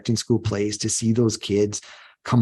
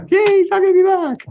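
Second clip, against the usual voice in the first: high, squealing mock cheer, much louder; mock student cheer; 8 dB louder than usual. Teacher mocking a student's cheer, high and squealing. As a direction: play the gleeful kid cheering he's leaving.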